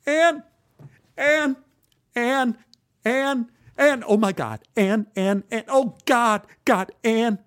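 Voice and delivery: high, frantic repeated calls; overwhelmed, high-pitched exclaiming; screaming. A narrator's reading. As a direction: cry out her name over and over. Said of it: "I screamed".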